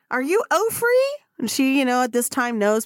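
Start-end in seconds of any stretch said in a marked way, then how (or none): 0.1-1.1 put-on much higher, bright young girl voice; chirpy little girl. Little Becky running up to Oprah on the track, high girlish voice. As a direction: pipe it up like a little kid. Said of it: "And she's crying and".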